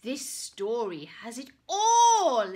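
loud, bright exclamation; a knight's excited cheer; exclaiming. Acted. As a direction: cry it out with delight. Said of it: "exclaimed the knight".

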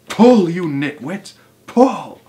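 loud, high, drawn-out command; yelling. A narrator's reading. Mugglewump drives helpers hauling the carpet, yelling. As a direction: heave each word out as an order. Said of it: "yelled Mugglewump".

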